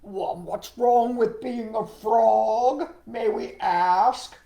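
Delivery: loud, very slow frog challenge; huffy, drawn-out storybook frogs; indignant exclamation. Acted. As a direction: exclaim it as offended frogs, every word stretched. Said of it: "exclaimed the frogs".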